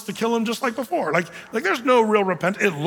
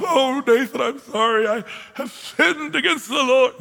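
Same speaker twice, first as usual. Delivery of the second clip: hammed-up high wailing confession; mock sobbing; 6 semitones higher than usual. David confessing to Nathan, mock sobbing. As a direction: blubber it out, pitched up and drawn out. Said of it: "Does he sob".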